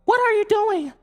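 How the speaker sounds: high alien voice, offended; indignant alien. A narrator's reading. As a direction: protest like a vain alien. Alf's voice in the story.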